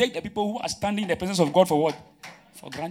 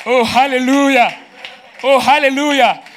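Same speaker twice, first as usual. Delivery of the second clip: rousing praise call; 5 semitones higher, 9 dB louder than usual. Real, not acted. Preacher calls hallelujah to congregation, loud and rousing.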